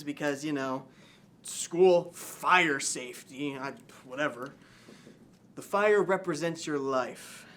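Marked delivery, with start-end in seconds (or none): none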